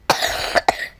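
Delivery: a real cough. Real, not acted. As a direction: cough once. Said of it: "Eh, batuk".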